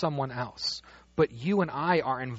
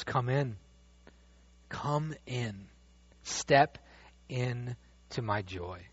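gentle invitation; 2 semitones lower, 5 dB quieter, much slower than usual. Retold or imitated. Father inviting the older son.

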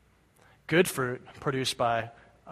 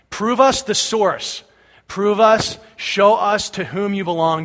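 loud, high, slow demand; demanding proof, raised and drawn out; insistent demand; 5 semitones higher, 7 dB louder, slower than usual. Acted. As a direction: press each word like an impatient challenger. Acting out the Pharisees' demand.

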